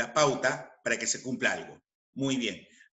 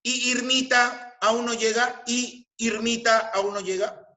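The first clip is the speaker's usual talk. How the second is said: high sustained question from "Y Irmita"; questioning tune; 7 semitones higher than usual. Shown as a demonstration. Teacher showing an interrogative y, high rising question. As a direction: ask it high, lifting to the end. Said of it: "interrogativas cuando equivale a ¿dónde está?".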